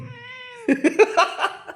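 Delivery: high-pitched burst of real laughter; laughing. Real, not acted. Teasing the pet about being laughed at, laughing. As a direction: break into a high, giggly laugh. Amused that mother laughs at dog.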